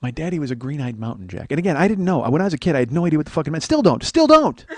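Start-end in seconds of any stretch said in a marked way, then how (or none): 4.0-4.6 much higher, louder comic squeak; mock squeal. Podcaster repeating his joke, in a louder, much higher comic yelp. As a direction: yelp it for the laugh.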